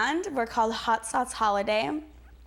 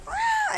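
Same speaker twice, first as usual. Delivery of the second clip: put-on sudden squealed ah at a girl; high yelp; 6 dB louder than usual. Flashing a girl, a sudden high yelp. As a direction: yelp high to startle her.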